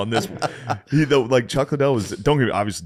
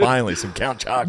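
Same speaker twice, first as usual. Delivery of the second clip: mock fighter; 4 semitones higher, faster than usual. Fighter voiced, joking about cereal.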